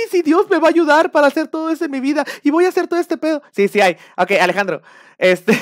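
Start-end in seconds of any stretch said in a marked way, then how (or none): 0.1-3.4 high, louder hammed-up devotion; mock fervent believer. Believer vowing God will help, mock fervor. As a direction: gush like a true believer.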